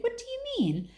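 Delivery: sharp high startled question. Acted. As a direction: ask it in sudden disbelief. Craven startled by request.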